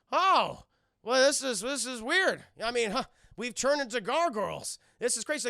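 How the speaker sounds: high loud confused voice; bewildered. Retold or imitated. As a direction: gush it out totally puzzled. Gargoyles confused at turning human.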